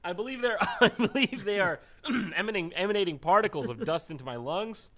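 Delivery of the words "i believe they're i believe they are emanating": giggling through the words; laughing. Real, not acted. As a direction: laugh as you speak. Laughing while explaining.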